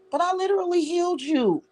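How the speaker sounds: high stress on literally; emphatic insistence. Real, not acted. Repeating to Mike she healed him, high emphatic.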